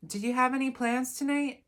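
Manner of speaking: high, loud interrogating question; probing mom. Acted. Mom digging about teenager's plans, probing. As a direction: keep prying, voice lifted.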